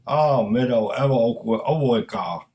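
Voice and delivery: put-on slow, heavy Chinese accent mangling the name. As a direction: say the name as Chang would, with his Chinese accent. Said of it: "With his accent, it sounded like".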